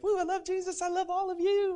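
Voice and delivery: very high, gushing mock falsetto; hammed-up churchgoer overflowing with love; excited worshippers. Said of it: "they get excited for Jesus and they're like".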